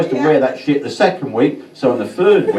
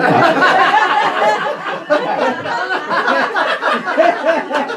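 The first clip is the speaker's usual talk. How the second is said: high laugh. Real, not acted. Comedian laughs at own joke.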